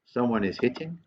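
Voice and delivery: normal talking voice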